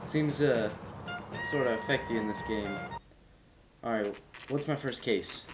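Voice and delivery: usual speaking voice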